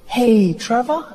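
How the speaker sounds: high, drawn-out greeting lifting at the end; sly body voice. Acted. Body tentatively calling comic at breakfast, high coaxing. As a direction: sidle up as your own body. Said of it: "my body was like".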